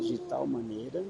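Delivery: soft, slow, left hanging on a rise; feed it slowly and softly for repeating; quiet prompting. Shown as a demonstration. Dictating the verse for a child to repeat, quiet prompting.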